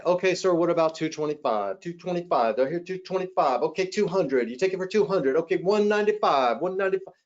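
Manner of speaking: quick rising auctioneer patter, dropping prices. Shown as a demonstration. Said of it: "You sound like the auctioneer".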